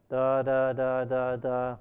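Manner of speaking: flat droning da da da; monotone. Shown as a demonstration. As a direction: drone on one pitch. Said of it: "Monotone, like".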